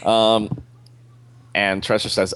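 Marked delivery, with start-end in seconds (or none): none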